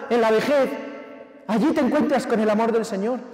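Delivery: loud, high, impassioned preaching; full-force preaching. Acted. Preacher urging his congregation, preaching at full force. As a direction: drive it home to the congregation.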